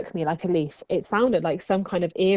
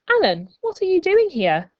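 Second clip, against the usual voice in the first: surprised; 11 semitones higher, 4 dB louder than usual. Acted. Maria surprised to find Alan.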